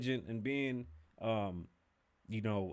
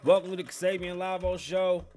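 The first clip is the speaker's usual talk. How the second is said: higher, louder hype host opening; podcast host intro; 7 semitones higher, 7 dB louder than usual. Shown as a demonstration. Host kicks off the show, hyped announcer intro. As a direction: greet the audience like a big show host. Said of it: "I'm just gonna redo the whole intro".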